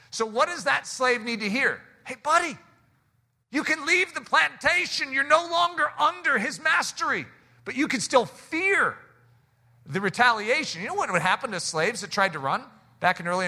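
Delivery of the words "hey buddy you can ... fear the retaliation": high-pitched, urgent proclamation; acted announcement, pitch lifted high; calling out news. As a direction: call it out to someone who must hear. Calls out news to freed slave.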